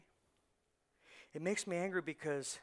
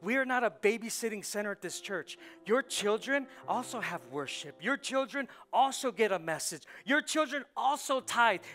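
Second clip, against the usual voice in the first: louder and higher, line by line; full-force preaching; 6 semitones higher, 7 dB louder than usual. Acted. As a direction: preach it like a rally, climbing.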